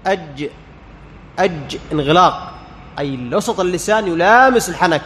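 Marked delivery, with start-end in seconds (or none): 0.1-1.8 "أج" stopped fully at mid-tongue, then released; closed jeem. Shown as a demonstration. Teacher shows closure of the makhraj, "أج" with a firmly stopped jeem. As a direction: shut off the j in "أج" completely. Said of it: "الجيم يحصل معها انغلاق للمخرج".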